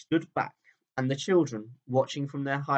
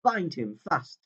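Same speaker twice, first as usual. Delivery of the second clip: high and slow, gloating witch voice; witch crying out; 7 semitones higher, 5 dB louder than usual. A narrator's reading. Witch ordering her creatures to bind Aslan, high gloating cry. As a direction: drag out the gloat, then snap the order. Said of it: "she cried".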